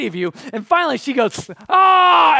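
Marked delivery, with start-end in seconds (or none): 1.7-2.4 loud, high yell of pent-up anger; acted scream as the anger blows; explosive outburst. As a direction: burst out in a mock-exploding "Ah!". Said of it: "and it explodes".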